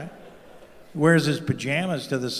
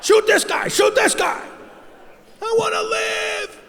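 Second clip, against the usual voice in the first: panicked shout. Retold or imitated. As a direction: scream for help like a coward. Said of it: "I'd be like".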